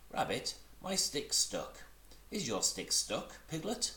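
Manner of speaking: high, eager small kangaroo; little Roo voice. Acted. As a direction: chatter up high like a tiny child.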